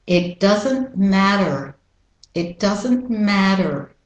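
slow, American R on "matter"; American "matter" with tapped D and er; American pronunciation. Shown as a demonstration. Teacher models the phrase slowly, American accent. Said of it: "with the American pronunciation".

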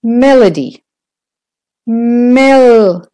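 "melody" with a long, high MEL; stress on MEL. Shown as a demonstration. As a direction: hold the first syllable of "melody". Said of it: "that's louder and longer".

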